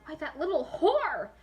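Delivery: high, loud, slow outrage; outraged shout. Acted. Rin shouting at the cheating Sakura, outraged. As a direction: spit the insult out furious. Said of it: "Rin said, shocked".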